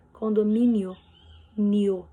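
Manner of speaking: "Condomínio" with final "nio" as one syllable; "nio" in one beat, vowels joined; diphthong kept together. Shown as a demonstration. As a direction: say "Condomínio", then isolate "nio" as a single unbroken syllable. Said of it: "O I e o O não são separados".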